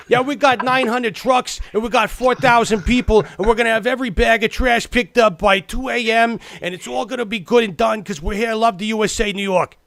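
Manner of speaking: high, loud, over-the-top enthusiasm; gung-ho, hammed-up excitement; mock excited. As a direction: imitate a hugely excited city official. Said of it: "He was so excited".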